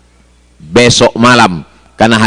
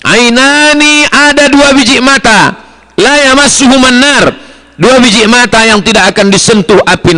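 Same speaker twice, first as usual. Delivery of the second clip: much higher, ringing hadith recital; raised recitation; 12 semitones higher than usual. Acted. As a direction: lift the voice high to proclaim the hadith.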